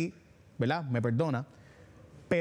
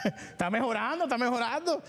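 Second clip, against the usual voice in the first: higher, chuckling through the words; laughing sarcasm; 7 semitones higher than usual. Real, not acted. Host mocks the comptroller's claim of improvement, laughing. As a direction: laugh it off in disbelief.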